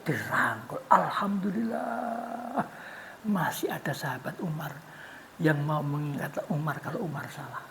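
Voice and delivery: hushed, grateful whisper. Acted. Preacher marvels at Umar's friend, whispered. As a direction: breathe it in awe.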